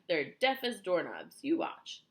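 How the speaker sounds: loud, slow, scornful boast; brash, emphatic dare; cried out. A narrator's reading. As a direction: hit each word loudly. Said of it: "he cried".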